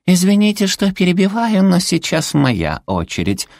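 very high, sweetly interrupting little creature; cutesy creature villain. A narrator's reading. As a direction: cut in with a sugary high voice. Зверёк перебивает героев. (милый высокий голосок зверька-злодейки)

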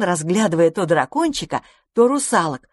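ordinary delivery

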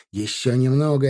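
usual speaking voice

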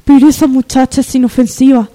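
changed voice. Acted. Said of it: "le ha vuelto a cambiar la voz a Verónica".

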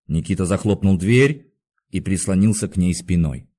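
ordinary delivery

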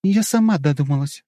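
put-on self-satisfied girl's reply; smug. A narrator's reading. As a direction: say it proud of yourself. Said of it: "самодовольно ответила Юлия". (самодовольно)

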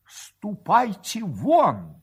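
slow, high, heavy dismissal; muffled order. A narrator's reading. Husband throwing wife and rival out, muffled angry order. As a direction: order them out with drawn-out words. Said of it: "глухо сказал Матушкин".

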